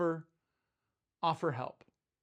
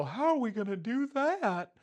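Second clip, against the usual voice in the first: higher put-on whine, "How are we gonna do that?"; whiny disciples; 6 semitones higher than usual. Disciples doubting Jesus' feeding plan, high whiny mock voice. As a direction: play the clueless disciples, pitched up. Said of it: "they all go".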